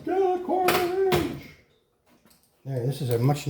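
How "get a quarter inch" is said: very high, drawn-out pretend shout; mock yelling. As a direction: imitate exasperated viewers yelling from afar, stretched out. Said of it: "You guys are probably yelling".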